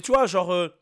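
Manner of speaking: ordinary talk, nothing marked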